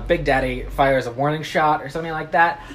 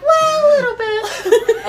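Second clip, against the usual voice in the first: very high, louder falsetto whine, drawn out; mock-weepy Texan daughter squeak; Ruth; 5 dB louder, slower than usual. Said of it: "she talks like that clearly".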